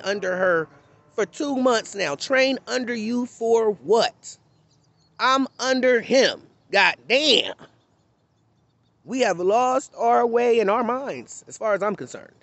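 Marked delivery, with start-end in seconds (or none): 2.1-11.4 mock preacher. Preacher parody mid-rant.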